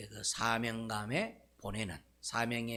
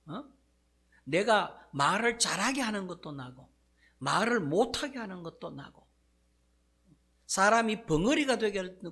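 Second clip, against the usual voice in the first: God scolding Moses, higher and louder; thundering rebuke; 8 semitones higher, 5 dB louder than usual. Acted. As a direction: bellow it like an angry God. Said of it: "하나님께서 모세를 막 호통을 냅니다".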